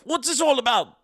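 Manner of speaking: high, loud, hammed-up British accent. Shown as a demonstration. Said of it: "do my best British accent".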